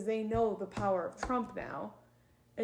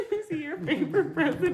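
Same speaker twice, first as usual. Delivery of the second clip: laughing; 7 semitones higher, 6 dB louder than usual. Real, not acted. Laughing exchange.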